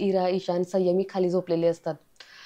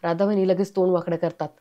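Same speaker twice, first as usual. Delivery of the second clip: ordinary delivery, nothing marked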